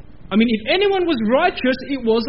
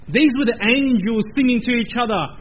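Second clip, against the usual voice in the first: ordinary talk, nothing marked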